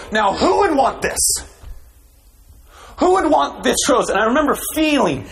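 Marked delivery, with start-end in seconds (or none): 0.1-4.1 mock grand crescendo. Pastor mimicking the minister's big crescendo, hammed up. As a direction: hold up the rose like a showman, mocking. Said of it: "his point is to hold up that rose and go".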